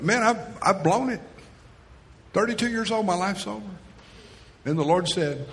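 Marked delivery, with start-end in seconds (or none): 0.0-3.6 despairing thought. Retold or imitated. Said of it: "I thought".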